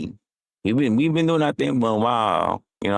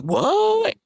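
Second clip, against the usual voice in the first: shocked what. Retold or imitated. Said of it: "she was like".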